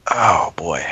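ordinary talk, nothing marked

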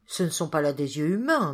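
ordinary delivery, nothing marked

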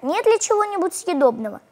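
plain reading voice

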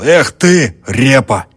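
loud, high scoffing taunt; mocking jeer. A narrator's reading. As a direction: jeer at him. Coachman laughing, mocking host.

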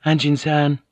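casual, factual tone; matter-of-fact. A narrator's reading. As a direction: address him neutrally, giving nothing away. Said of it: "her voice matter-of-fact".

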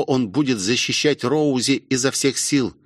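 plain reading voice

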